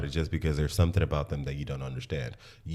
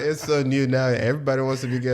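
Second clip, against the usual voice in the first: higher mock Honduran accent, quick on the second line; imitating her accent; 7 semitones higher, 4 dB louder than usual. Mocking the Long Beach classmate's claim, in a high Honduran accent. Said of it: "She got the little accent".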